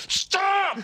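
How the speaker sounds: sudden high shriek; mock scream. Resuming the spoon torment after a calm pause, mock scream. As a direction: burst out high in fake alarm. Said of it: "I'm screaming at her".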